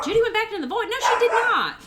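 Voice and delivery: put-on high, loud English character accent slipping in; Judy's accent. Streamer slipping into the character's English accent, laughing. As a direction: let the English character voice leak through, laughing. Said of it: "That was still Judy's accent".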